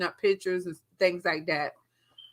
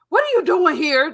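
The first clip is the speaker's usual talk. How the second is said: high, loud what are you doing; startled shout; 8 semitones higher, 7 dB louder than usual. Retold or imitated. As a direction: demand it in surprise. Husband confronts intruder.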